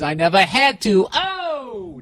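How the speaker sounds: swaggering mock brag rising into a high drawn-out "oh"; cocky joke boast. Host joking he never had to, cocky mock brag. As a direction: crow it, climbing to a high held whoop.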